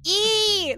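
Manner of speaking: squeaky high E like Mini-Me; high squeal. Shown as a demonstration. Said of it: "one of my favorite noises to make is E".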